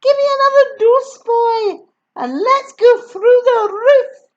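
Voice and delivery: high, loud, fast crone call; Grandma demanding another dose; old crone demand. Acted. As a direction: demand it greedily. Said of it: "the old scratchy voice".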